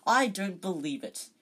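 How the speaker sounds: captain outraged at rivals, loud and biting; outraged hiss. Acted. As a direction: spit out the disbelief. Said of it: "Wood hissed in outrage".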